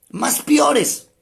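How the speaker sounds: jokey Peruvian colloquial piores; folksy Peruvian slang. Retold or imitated. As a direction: play up the Peruvian street phrase piores. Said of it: "como dirían algunos aquí en el Perú".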